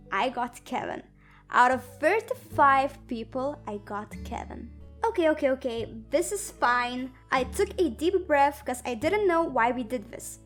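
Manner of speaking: usual speaking voice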